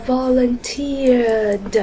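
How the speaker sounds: "volunteered" stretched very slowly to a released d; final d sound. Shown as a demonstration. Teacher completes the exercise, "volunteered" slowly ending in d. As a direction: draw out "volunteered" and finish on d. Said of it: "còn lại thì chúng ta đọc là d".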